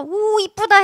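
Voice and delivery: high, loud mock cooing; hammed-up adoring exclamation; doting mother. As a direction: gush over an adorable newborn. Acts mother doting on newborn.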